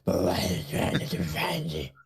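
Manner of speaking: wheezing, voiceless superhero rasp; Batman. Pretend. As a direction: overdo the vigilante growl until it is all breath. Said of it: "really bad case of emphysema".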